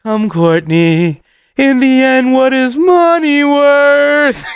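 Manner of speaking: slow, drawn-out grand proclamation; mock-profound high philosopher, stretched out; blissed-out stoner. Grandly high self, retold.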